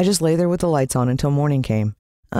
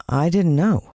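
plain reading voice

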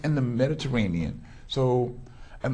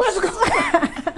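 very high, loud laughing echo; excited squeal; 8 dB louder than usual. Real, not acted. Host echoing the guest's pick with a delighted high laugh. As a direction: shriek her answer back in delight.

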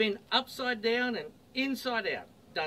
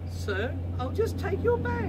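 higher, polite hostess voice; 10 semitones higher than usual. Acted. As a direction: play the smiling stewardess, up high. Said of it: "She gave a friendly smile".

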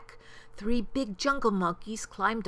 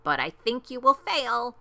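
higher, louder gloating taunt; scornful sing-out of doubt; sneering; 6 semitones higher, 6 dB louder than usual. A narrator's reading. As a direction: sneer it like a smug bird. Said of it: "sneered the bird".

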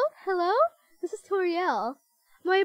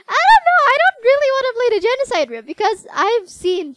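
high, loud, emphatic no; loud protest; 9 semitones higher, 12 dB louder than usual. Real, not acted. Gamer loudly refusing the genocide route. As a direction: blurts it out, much louder and higher.

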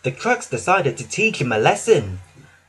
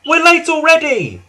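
impatient cry; 13 semitones higher, 7 dB louder than usual. Acted. Passengers shouting at the arguing crew. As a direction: cry out at them to stop. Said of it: "cried the passengers".

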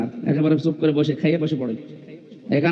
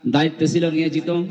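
ordinary delivery

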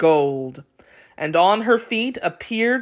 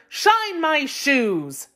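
slow, higher, haughty order; spoiled sister giving a slow command; bossy stepsister; 8 semitones higher, much slower than usual. A narrator's reading. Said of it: "shouted the older stepsister".

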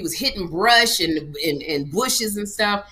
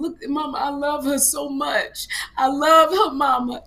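pleading; 6 semitones higher, 3 dB louder, slower than usual. Retold or imitated. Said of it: "he told his mom".

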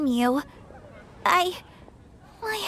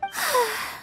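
heavy dejected sigh; 8 semitones higher, 4 dB quieter than usual. Acted. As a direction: let out a long weary sigh. Asked about failed date.

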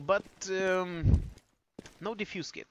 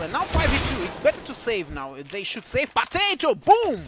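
building excitement; 5 semitones higher, 7 dB louder than usual. Real, not acted. Caster building on a play.

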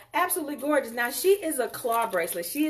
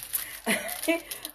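airy giggle; 6 semitones higher, 4 dB quieter than usual. Real, not acted. Laughing at her own joke.

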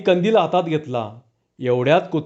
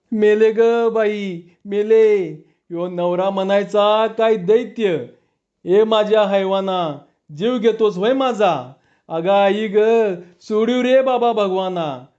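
high, slow hammed-up wailing, dragging the words; fake wailing; 3 semitones higher than usual. Wife fakes being beaten to scare off thieves, hammed-up wailing. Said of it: "तीच रडत म्हणाली".

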